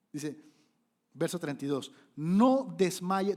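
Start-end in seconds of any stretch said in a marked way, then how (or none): 2.2-3.4 slow proclaimed. Retold or imitated. David quote slowed.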